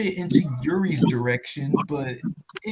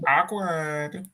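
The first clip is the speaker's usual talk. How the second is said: Lumpy Space Prince voice; 3 semitones lower than usual. Pretend. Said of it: "can you do Lumpy Space Prince's awkward voice".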